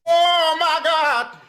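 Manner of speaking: slow, high disbelief; shocked. Pretend. Reacting to Chad's words in stunned disbelief. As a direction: drag it out in shock. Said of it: "I'm like".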